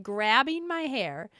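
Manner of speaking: ordinary talk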